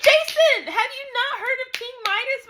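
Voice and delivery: very high, loud scolding cry; exasperated shriek. Acted. Reader scolding Jason about King Midas, exasperated shriek. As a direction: shriek at him in disbelief. Yells at clueless character Jason.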